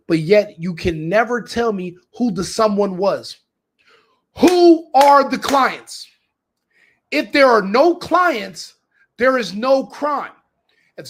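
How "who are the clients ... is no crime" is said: loud, slow, much higher shouted challenge; angry demand. Real, not acted. Ranting to viewers about a trial with no clients, loud slow shout. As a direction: hammer each word out slowly and loudly.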